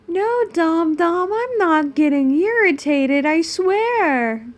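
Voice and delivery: loud, high-pitched mock protest; exaggerated irritation. Reader insists to a viewer she isn't irritated, exaggerated protest. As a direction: swoop up loudly in over-the-top denial.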